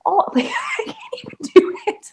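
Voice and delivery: high laughing giveup; laughing at failed accent. Real, not acted. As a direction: laugh through the failed try. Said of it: "I'm really bad at accents".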